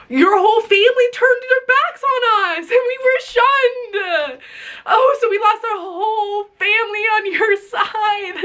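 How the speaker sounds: holding back tears while telling it; crying through the words; tearful. Real, not acted. Recounting being shunned, breaking down.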